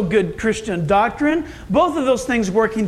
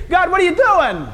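high, exasperated cry of "why me"; exasperated complaint to God; 8 semitones higher, 3 dB louder than usual. Retold or imitated. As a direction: plead at God with frustrated disbelief. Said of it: "How many times are we tempted to say".

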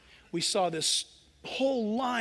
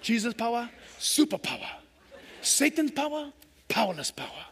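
imitating an African accent. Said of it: "I wish I could talk like that".

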